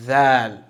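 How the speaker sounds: Dal loud with a buzzing vibration; voiced dal. Shown as a demonstration. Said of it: "Make the vibration sound".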